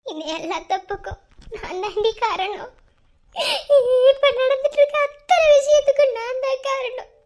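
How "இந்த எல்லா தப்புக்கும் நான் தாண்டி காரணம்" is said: much softer, lower, barely voiced; almost too broken to speak; broken murmur. Broken whisper of guilt. (மிக மெதுவான உடைந்த குரல்)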